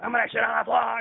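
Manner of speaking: loud, high imitation of a threat; retold yell. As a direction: holler it like a ranting man. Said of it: "he said".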